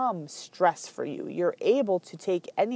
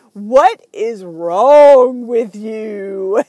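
very slow, much louder TV-host scolding; Doctor Phil; 14 dB louder, much slower than usual. Retold or imitated. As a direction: drag out each word like a stern TV therapist. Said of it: "I just heard his voice".

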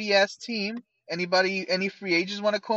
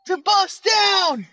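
loud, high-pitched locker-room tirade; hammed-up coach shouting at the team; coach's rousing speech; 13 semitones higher, 7 dB louder than usual. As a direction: bellow it like a fired-up coach in a pep talk. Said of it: "Coach is gonna be in there like".

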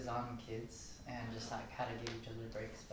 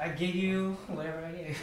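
higher, louder, laughing through the quote; laughing exasperation; 7 semitones higher, 8 dB louder than usual. Retold or imitated. Wife laughing as she quotes herself snapping at her husband, loud. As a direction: blurt it out laughing in frustration.

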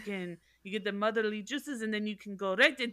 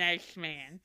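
Carol Channing; 5 semitones lower than usual. Pretend. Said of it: "Now I'm doing Carol Channing".

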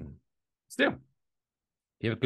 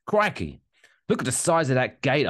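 pitched-up, brisk put-on Aussie accent; Australian accent; 4 semitones higher than usual. Host quotes police joke about Steve Irwin, mock Australian accent. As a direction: exclaim it like an Australian wildlife host. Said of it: "I don't know why I'm doing the Australian accent now".

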